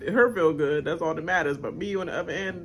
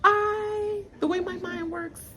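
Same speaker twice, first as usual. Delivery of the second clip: imitating Danielle; 10 semitones higher, 5 dB quieter than usual. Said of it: "She's like".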